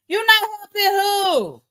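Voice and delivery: high, indignant shouted question; mock outrage. Streamer answers viewer's refusal to help, mock outrage. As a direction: shout it in disbelief, pitch way up.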